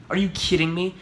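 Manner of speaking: usual speaking voice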